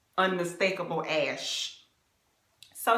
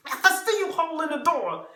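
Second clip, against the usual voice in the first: mocking his call; 7 semitones higher, 5 dB louder than usual. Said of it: "And I'm like".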